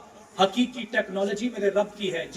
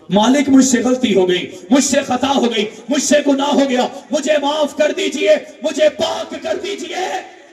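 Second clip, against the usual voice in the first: rising, louder shouted plea for forgiveness; wailing penitent, breaking into a shout and slowing at the end; anguished crying plea; 5 semitones higher, 10 dB louder than usual. Acted. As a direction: cry out to God, begging harder each line.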